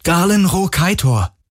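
high, urgent pleading call. A narrator's reading. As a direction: call across to someone who may not remember you. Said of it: "rief er laut". (eindringliches, hohes Rufen)

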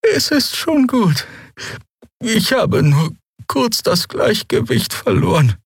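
langsam, hoch, fremde alte Stimme; alte Draxyl beschwichtigt. A narrator's reading. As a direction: die alte Heilerin spielt es herunter. (alte Heilerin, beschwichtigend)

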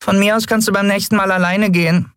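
tiny winged creature, very high squeak; high piping complaint; squeaky Nelen. A narrator's reading. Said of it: "piepste Nelen".

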